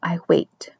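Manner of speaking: hushed, half-whispered words. A narrator's reading. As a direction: murmur it softly to yourself. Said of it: "he whispered".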